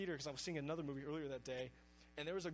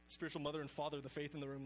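ordinary delivery

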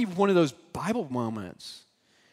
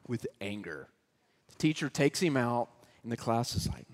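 usual speaking voice

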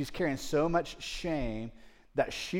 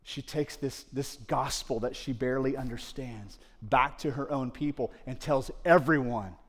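ordinary delivery

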